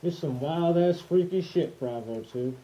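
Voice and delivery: muffled voice inside a gas mask; through a gas mask. Real, not acted. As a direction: talk with the mask over your face. Demoing the gas mask while wearing it.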